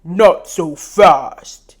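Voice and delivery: loud, slow, booming command; slow, heavy "Not so fast". Acted. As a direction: stop her dead with a booming voice. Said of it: "his voice booms".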